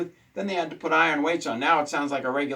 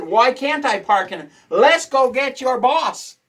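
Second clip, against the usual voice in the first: loud, higher, slowing on let's go get your boss; angry retort to the stubborn guard, louder and higher; retold angry demand; 9 semitones higher, 7 dB louder, slower than usual. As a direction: demand to see his boss, loud and deliberate.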